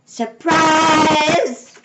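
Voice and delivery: big, high drawn-out shout; shouted surprise. A narrator's reading. All the ladies surprise Miss Alma, shouting. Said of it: "all the ladies shouted".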